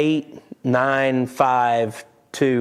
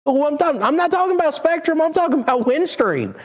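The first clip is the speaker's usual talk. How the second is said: emphatic correction. Real, not acted. Clearing up which company he means, emphatic and much higher. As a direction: hit it high to set the record straight.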